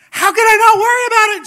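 very high, loud mock-panicked shriek; hammed-up panic at the top of the voice; freaking out. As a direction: blow up like a frantic wife. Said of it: "She's just freaking out".

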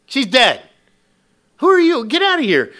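mocking ridicule. Paid mourners jeering at Jesus, mocking. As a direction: scoff at him like a heckler. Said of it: "they're making fun of Jesus".

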